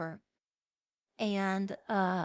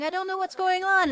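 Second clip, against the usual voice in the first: pained panic; 7 semitones higher, 5 dB louder than usual. Retold or imitated. Characters collapse in pain.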